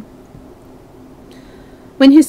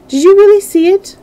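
higher, louder burst of surprise; startled question; 12 semitones higher, 7 dB louder than usual. Acted. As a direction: blurt it out as an urgent question. Dorian's startled question to the painter.

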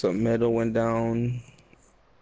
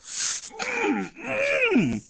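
high shouted pained laugh. Real, not acted. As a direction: burst out half laughing, half crying. Pained outburst.

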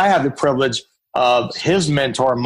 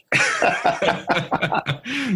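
soft laugh, higher in pitch; real laugh; 6 semitones higher, 4 dB quieter than usual. Real, not acted. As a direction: let a quiet laugh out after the joke. Laughs at own joke.

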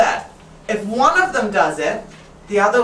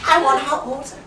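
lightly voiced demanding child, pitched up; a kid's higher-pitched demand; complaining child; 6 semitones higher than usual. Acting out kids complaining at home.

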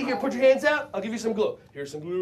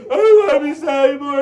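pretend sad; 7 semitones higher, 13 dB louder than usual. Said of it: "Let's pretend like we're sad".

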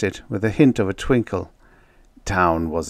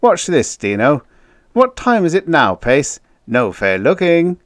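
high, loud, gleeful challenge; playful showing-off; 8 semitones higher, 9 dB louder than usual. A narrator's reading. Joker testing outpost man's clock sense, playful. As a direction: pitch it up loud like a dare.

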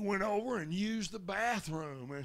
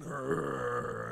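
low growl. Retold or imitated. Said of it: "and growled".

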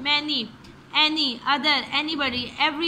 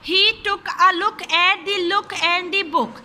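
slow and a bit louder, every word spelled out; slow tongue twister demo; 3 semitones higher, 5 dB louder, much slower than usual. Shown as a demonstration. Teacher showing students how to say it, slow and careful. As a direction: model the tongue twister slowly. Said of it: "मैं आपको बोल के एक दफ़ा बताती हूँ".

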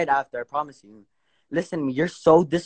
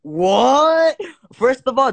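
high, loud, disbelieving what; stunned outburst at the claim; shocked what; 7 semitones higher, 4 dB louder, much slower than usual. Real, not acted. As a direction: blurt it out in disbelief.

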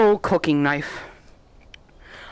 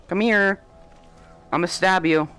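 ordinary talk, nothing marked

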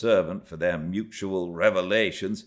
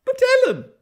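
put-on high, loud girlish protest; astonished cry; 10 dB louder, faster than usual. A narrator's reading. Young Cathy appealing to Ellen, high astonished cry. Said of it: "staring fixed in astonishment".

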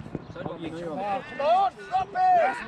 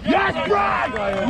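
shouting; 3 semitones higher, 5 dB louder than usual. Real, not acted. Coach cheering goal.

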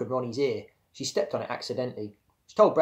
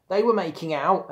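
higher, louder tattletale announcement; announcing; 7 semitones higher, 6 dB louder than usual. A narrator's reading. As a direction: announce the gossip to everyone. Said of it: "announced the Mexican donkey".

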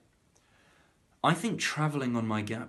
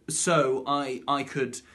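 usual speaking voice

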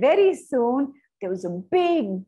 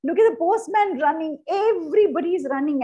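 higher, louder cry of excitement; excited storytelling; 7 semitones higher, 4 dB louder than usual. Shown as a demonstration. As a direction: shout it out like breathless story drama. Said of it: "you can say".

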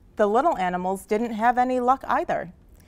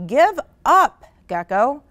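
loud, high taunting shout; rising, punched-out call; 7 semitones higher, 8 dB louder than usual. A narrator's reading. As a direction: shout it like a jeering crowd. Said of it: "All of the animals shouted".

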